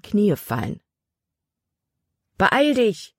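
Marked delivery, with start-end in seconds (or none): 2.4-3.1 high-pitched calling out; urgent call. A narrator's reading. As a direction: call to her to hurry, voice pitched right up. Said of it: "rief Caleb". (hoher, drängender Zuruf)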